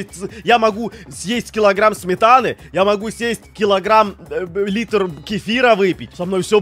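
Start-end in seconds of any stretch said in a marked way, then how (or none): none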